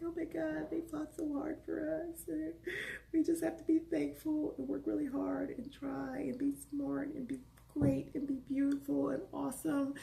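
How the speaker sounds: high, soft, breathy-voiced retold crying; teary gushing. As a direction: play yourself choked up and grateful after the film. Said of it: "I was crying like".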